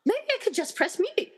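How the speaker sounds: gasp, then a high bright idea; sudden realization. Acted. Tina realizes she can press mute, sudden gasp of realization. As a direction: gasp as it dawns on you. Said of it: "Sudden realization".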